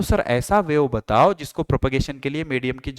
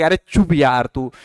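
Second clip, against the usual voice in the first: annoyed listener; 2 semitones lower than usual. Retold or imitated. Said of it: "आवाज सुनते लगे".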